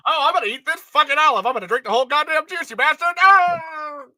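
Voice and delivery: put-on high, loud old radio announcer; old-timey radio voice. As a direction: ham it up like a 1930s radio host. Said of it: "the old-timey radio voice".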